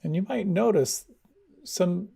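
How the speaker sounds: guided meditation. Acted. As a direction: ease them inward, unhurried. Leads a meditation.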